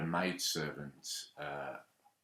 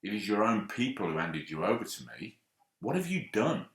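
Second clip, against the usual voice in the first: louder, indignant challenge, rising to a high cry; really irritated; 4 semitones higher, 6 dB louder than usual. A narrator's reading. Pilate confronting Jesus over his own people, irritated and loud. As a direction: let the irritation boil over on the last question. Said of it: "really irritated".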